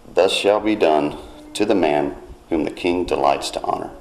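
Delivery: normal talking voice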